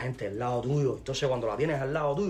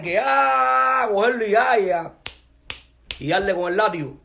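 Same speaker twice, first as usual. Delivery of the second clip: higher and louder, dragged out; angry rant; 7 semitones higher, 9 dB louder than usual. Real, not acted. Ranting at rival about punishing artists, angry shout.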